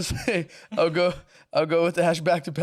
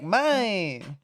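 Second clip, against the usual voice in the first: drawn-out creature call, swooping up and down; Mister Mime's cry; 3 semitones higher than usual. Acted. Mister Mime calling out to Ash, drawn-out cry. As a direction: stretch out the one word like a cartoon creature. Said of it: "And then it's Mister Mime".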